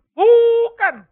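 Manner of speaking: a loud, high, firm no; shouted denial from the pulpit; emphatic no. Real, not acted. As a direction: cut the idea off hard. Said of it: "preacher's emphatic denial".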